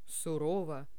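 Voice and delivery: stern. Shown as a demonstration. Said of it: "Я могу читать".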